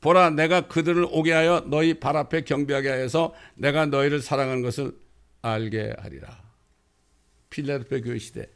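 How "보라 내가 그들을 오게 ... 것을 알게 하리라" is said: emphatic preacher's reading, steadily pressing on; forceful scripture recitation. Acted. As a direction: declaim scripture from the pulpit, easing at the end.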